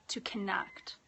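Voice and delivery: soft whisper of a single phrase; an unvoiced hush inside the invocation. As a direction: breathe the words out in a whisper. Quiet ritual invocation.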